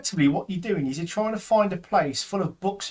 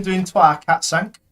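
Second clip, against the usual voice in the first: usual speaking voice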